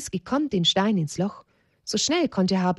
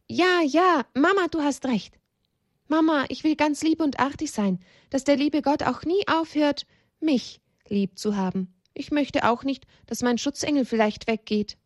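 put-on high-pitched, earnest small child's voice; eager little child; 7 semitones higher than usual. Child promises Mama to be good, eager and high-pitched. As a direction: pipe up like a keen little one.

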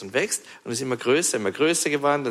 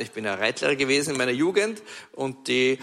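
ordinary talk

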